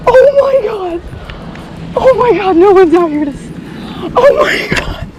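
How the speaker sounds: high, loud, tearful cries and thanks; overjoyed crying. Real, not acted. After catching a huge fish, crying loud, overjoyed thanks to God. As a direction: sob out the joy and praise. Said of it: "I'm going to cry".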